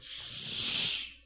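long hissed swoosh of a belt; drawn-out airy "shh" sound effect; belt-whipping-off sound. Retold or imitated. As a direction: imitate a belt sliding out of its loops. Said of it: "It was like".

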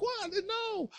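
lightly voiced sheepish child; innocent child. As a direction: feign surprised innocence. Innocent reaction after laughing.

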